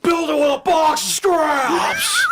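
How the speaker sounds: high, slow, laughing shout; hammed-up shouted villain line; Nick Cage as Obadiah shouting. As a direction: bellow it like an unhinged villain. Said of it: "I want Nick Cage to be Obadiah".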